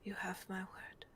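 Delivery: soft, hushed reassurance; tender whispered promise. Acted. Moira cups the girl's cheek, tender whispered promise. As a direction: whisper the vow gently.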